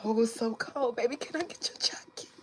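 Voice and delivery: high, whispered little plea for his jacket; mock needy girlfriend. Girlfriend on the cold ride begging for his jacket, mock whisper.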